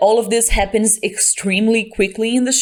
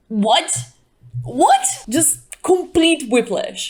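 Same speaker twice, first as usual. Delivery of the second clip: baffled; 5 semitones higher, slower than usual. Real, not acted. Baffled at scene.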